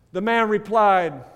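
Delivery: ordinary talk, nothing marked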